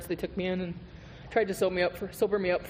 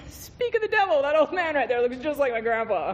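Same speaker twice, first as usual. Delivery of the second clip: much higher, louder joking exclaim; mock amused exclaim; 9 semitones higher, 5 dB louder than usual. Spotting grandpa-lookalike to friends, high loud joking. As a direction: play up a cheeky speak-of-the-devil jab. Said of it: "and I'm all".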